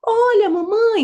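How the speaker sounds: put-on high, loud little-girl call; imitated excited child. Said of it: "vai começar a falar umas coisas".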